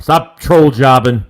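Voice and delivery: loud repeat. Shown as a demonstration. Host repeats a chat comment back to viewers, loud and slow. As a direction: call the phrase out loud to the chat.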